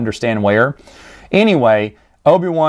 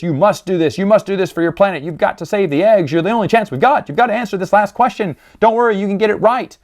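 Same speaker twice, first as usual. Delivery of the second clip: higher, faster earnest urging; urging Obi-Wan; 6 semitones higher, faster than usual. Retold or imitated. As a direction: push him to try once more.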